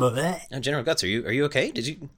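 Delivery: high, anxious concern; worried soldier. Pretend. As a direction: fuss over the general anxiously.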